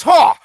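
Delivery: high, loud exclamation; scoffing "ha". Acted. British mech pilot hit by a rock, loud scoff. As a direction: bark out one indignant ha. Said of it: "He says".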